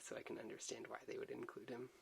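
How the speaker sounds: ordinary talk, nothing marked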